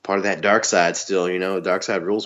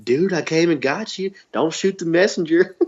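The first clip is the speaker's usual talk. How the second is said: Mideon impression; 9 semitones higher than usual. Mideon pleading with Taker, high mock Southern accent. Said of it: "that's your go-to Southern voice".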